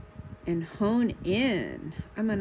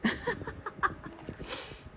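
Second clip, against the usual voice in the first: breathy giggle; 12 semitones higher, 7 dB quieter than usual. Real, not acted. Dog interrupts stream.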